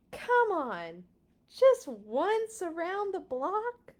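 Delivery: high, slow wheedling ask; pleading pigeon. Acted. Pigeon wheedling the reader, high and slow. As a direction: coax sweetly.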